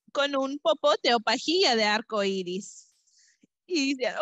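usual speaking voice

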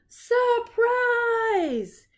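very high, loud party shout; shouted surprise. Acted. Friends greet Ernie at his birthday, shouting. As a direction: yell it like jumping out at a party. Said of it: "they all shouted".

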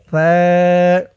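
tongue tip to upper teeth, draw out "সা"; one-alif stretch. Shown as a demonstration. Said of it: "সা এক আলিফ টান". ("সা" নরম করে এক আলিফ টেনে)

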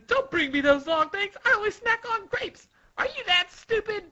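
very high, bratty outburst; scolding Sugar. Acted. Sugar rejecting servant's sausages, high-pitched tantrum. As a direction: screech the scolding.

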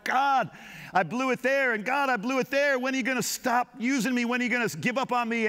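lightly voiced sinner begging God; pleading with God. Preacher voicing his own prayer to God, mild pleading. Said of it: "I look at my life and I go".

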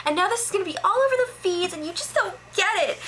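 high-pitched, rapid mock rant; hammed-up high-voiced tirade, words tumbling out; freaking out. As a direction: imitate her freak-out in a shrill, racing squeal. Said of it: "she starts freaking out".

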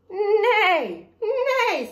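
high, loud mock whinny on a word; horse neigh. A narrator's reading. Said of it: "said the horse".

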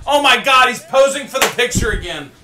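loud, high-pitched cry of exasperation; exasperated outburst. Real, not acted. Heckler watching a wrestler pose for pictures, exasperated shout. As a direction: shout it in sheer disbelief.

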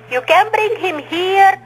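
normal talking voice

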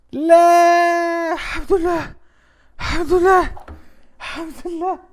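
very high, loud cries of thanks; real high-pitched outburst; ecstatic relief. Real, not acted. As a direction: shout your relief at the top of your range. Relief after dodging an unwanted country.

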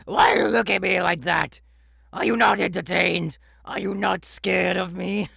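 loud, high mocking yell; taunting shout. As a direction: bellow the taunts at them. Captain taunting intruder.

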